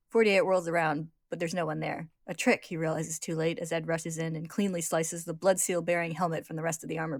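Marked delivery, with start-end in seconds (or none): none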